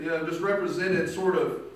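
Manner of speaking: ordinary talk, nothing marked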